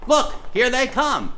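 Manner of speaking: usual speaking voice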